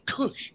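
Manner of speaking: high imitated burst; extinguisher noise. Teller imitating the extinguisher going off, sound effect. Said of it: "And it goes".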